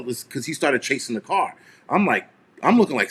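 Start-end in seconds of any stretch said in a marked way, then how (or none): none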